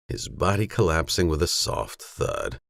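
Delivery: ordinary delivery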